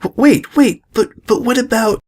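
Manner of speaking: frightened filly interrupts Celestia in alarm; alarmed stammer. Acted. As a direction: trip over the words in panic.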